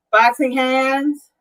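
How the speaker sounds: normal talking voice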